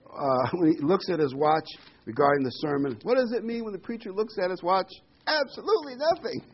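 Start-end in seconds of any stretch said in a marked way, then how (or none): 5.3-6.4 comic punchline. Acted. Preacher joke punchline.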